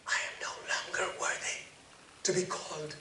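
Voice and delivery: fast, ashamed whisper. Acted. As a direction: let the shame steal your voice away. Plea drops to a whisper.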